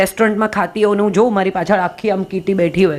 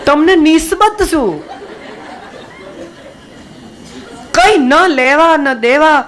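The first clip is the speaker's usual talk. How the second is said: scolding rhetorical question; 6 semitones higher, slower than usual. Real, not acted. Scolding gossiping women.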